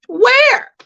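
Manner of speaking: a high, stunned "Where?"; incredulous "where". Retold or imitated. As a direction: shriek "Where?" in shocked disbelief. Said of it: "I said to myself".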